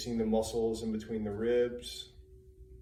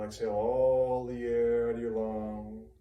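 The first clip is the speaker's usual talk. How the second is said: louder, sustained breathing cue; drawn-out exhale cue; 5 dB louder than usual. Shown as a demonstration. Teacher telling students to exhale completely, drawn-out exhale cue. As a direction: stretch the words over a full exhalation. Coaching a long full exhalation.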